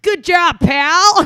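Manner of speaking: loud, high, mocking pat on the head; hammed-up condescending cheer; patronizing praise. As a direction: talk down to him like a child. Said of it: "I wasn't being like".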